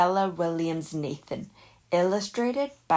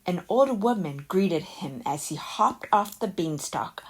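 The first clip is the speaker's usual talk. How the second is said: usual speaking voice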